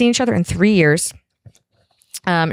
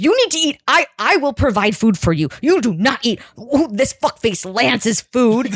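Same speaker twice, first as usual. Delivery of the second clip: higher, mock indignant rant; jealous outrage; 5 semitones higher than usual. Imitating jealous man forbidding rival's lunch, mock outrage. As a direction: lay down the law in hammed-up indignation.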